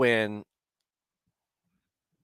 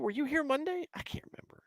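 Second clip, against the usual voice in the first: softer, fading into a whisper; trailing off to a whisper; 10 dB quieter, faster than usual. Real, not acted. Greeting viewer Matt, fading to a whisper while unsure. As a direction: drop to a whisper, half to yourself.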